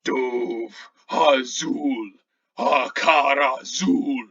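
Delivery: slow, loud incantation that ends in a shout; booming spirit voice. Acted. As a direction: chant each word slowly, rising to a roar on Pyre Maul. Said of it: "another voice in your head".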